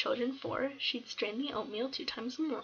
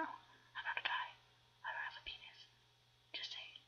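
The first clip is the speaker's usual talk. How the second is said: whispered aside; 11 dB quieter than usual. Real, not acted.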